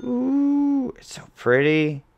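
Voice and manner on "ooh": high, drawn-out ooh; admiring ooh. Real, not acted. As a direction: coo a long, high-pitched ooh. Admiring a shiny card pull.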